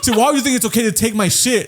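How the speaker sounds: put-on girlfriend's angry yell. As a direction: scold him like he stole from you.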